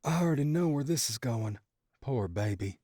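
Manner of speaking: a hint of a teasing mother; lightly voiced smirking mom. A narrator's reading.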